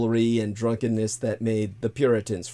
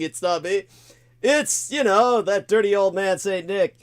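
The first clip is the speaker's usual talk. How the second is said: mock innocent blame; 8 semitones higher, 4 dB louder than usual. Imitating framers blaming Nick.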